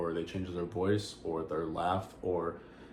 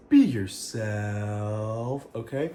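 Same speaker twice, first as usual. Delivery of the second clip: warm sign-off; much slower than usual. Real, not acted. Sign-off advice to viewers.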